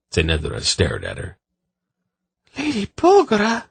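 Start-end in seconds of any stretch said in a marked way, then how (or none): 2.6-3.7 high, queasy distressed voice; sick voice. A narrator's reading. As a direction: sound sickened by the cruelty. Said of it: "she said in a sick voice".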